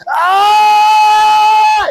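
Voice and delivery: long, high, loud wail; imitated drawn-out bawling scream; young man's howl. Young man told he will be president, long high howl. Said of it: "Y el muchacho es así".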